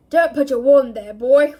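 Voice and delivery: loud furious roar. Acted. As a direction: roar it across the hall. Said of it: "roared Moody".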